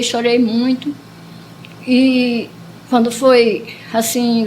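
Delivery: ordinary delivery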